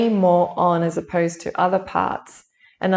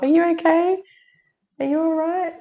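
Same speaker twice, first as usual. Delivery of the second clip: higher, worried check on the cat; concerned to pet; 11 semitones higher than usual. Real, not acted. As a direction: ask the cat if she's hurt.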